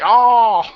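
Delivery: long high, loud "oh"; drawn-out groan. Acted. Host reacting to the Adabia stuff, drawn-out groan.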